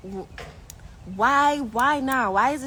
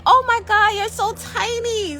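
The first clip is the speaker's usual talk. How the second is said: mock doctor gushing; 11 semitones higher, 5 dB louder than usual. Retelling the doctor at her pap smear, high gushing mock voice. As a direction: exclaim like a surprised doctor.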